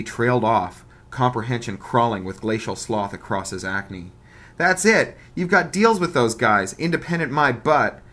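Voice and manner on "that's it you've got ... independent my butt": high, loud gotcha shout; triumphant outburst. Acted. Bully crowing at Bug, high loud accusing shout. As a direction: crow it like you caught him.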